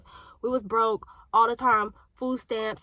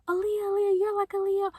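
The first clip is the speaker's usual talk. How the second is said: imitating people chanting her name, much higher; mock the kids who kept comparing her, pitched way up; teasing kids; 10 semitones higher than usual. People in her childhood comparing her to [name], high mock voice.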